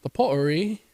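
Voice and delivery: "pottery" with a glottal stop; British dropped T. Shown as a demonstration. Echoing a friend, "pottery" without its T. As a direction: swallow the T in "pottery". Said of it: "they don't say their T's".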